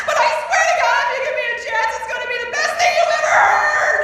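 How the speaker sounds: loud, high, laughing gush; fast, giddy, near-shouted plea; gushing pitch. Retold or imitated. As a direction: sell it with breathless passion. Retold pitch to the caller.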